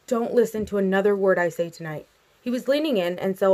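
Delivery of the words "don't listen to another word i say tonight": usual speaking voice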